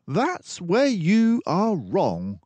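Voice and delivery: a composed mouse detective taking charge; Basil the detective. Acted. Basil corrects Dawson.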